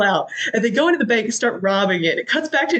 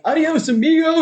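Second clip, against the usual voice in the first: mock yell; 9 semitones higher, 3 dB louder than usual. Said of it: "Bodhi yelling".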